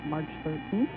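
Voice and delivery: ordinary talk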